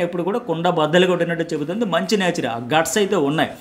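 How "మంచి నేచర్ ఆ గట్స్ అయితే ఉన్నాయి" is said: ordinary talk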